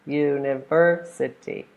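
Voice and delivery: slow u-ni-VER-si-ty, VER strongest; university syllable by syllable, stressing VER; third-syllable stress. Shown as a demonstration. Teacher counting syllables of university, slow, stressed on VER. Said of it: "Stress is on the third one".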